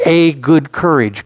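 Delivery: slow phrase with "a" said as a full "ay"; stress on A. Shown as a demonstration. Preacher returning to his key phrase, stressed "a". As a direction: make "a" a deliberate, separate word. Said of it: "Why do I emphasize that word "a".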